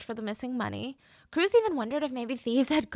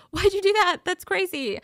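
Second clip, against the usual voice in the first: much higher, louder, scolding parent; mock angry parent; 9 semitones higher, 7 dB louder than usual. Narrator imagines scolding her kid, loud mock anger. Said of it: "'Cause I'd be like".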